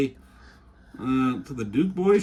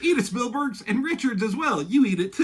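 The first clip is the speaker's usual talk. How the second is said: pitched-up gloating taunt; gloating villain; 7 semitones higher than usual. Pretend. Doom taunting Spielberg and Richards, hammed-up gloating. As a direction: rub it in their faces.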